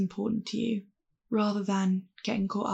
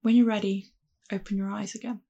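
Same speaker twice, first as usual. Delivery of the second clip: gentle voice bringing listeners back; calm grounding exercise. Acted. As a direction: ease them back into the room. Guides back to body sensations.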